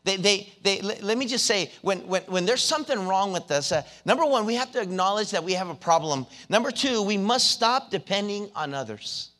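high, loud preaching at full force; preacher urging the young people in church, at full force. Acted.

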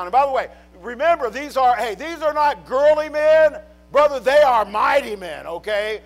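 loud, high, driving pulpit preaching; preaching at full force. Acted. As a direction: preach it hard to the congregation.

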